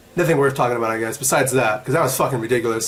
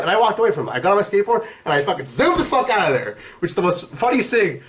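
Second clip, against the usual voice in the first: ordinary talk, nothing marked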